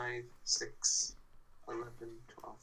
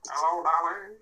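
put-on old-timey voice; 3 semitones higher than usual. Shown as a demonstration. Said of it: "That's a good old, old-timey voice".

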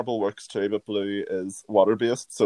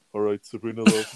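deep-voice teasing. Retold or imitated. Drag queen mimicking teasing about a deep voice, mock low. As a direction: lower mock "All right, Sabrina, love". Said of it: "That's a bit of a deep voice".

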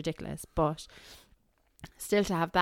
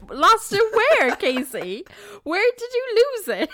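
mock outrage; 13 semitones higher, 8 dB louder than usual. Mock outrage at friend's text.

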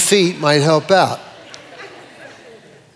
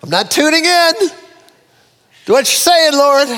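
mock confused believer; 9 semitones higher than usual.

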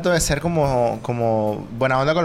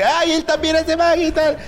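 higher, slow, gushing friend impression; mock gushing voice; 12 semitones higher, slower than usual. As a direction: gush about him like an eager fan. Mimicking friend's gushing remark.

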